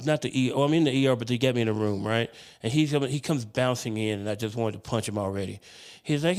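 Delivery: ordinary delivery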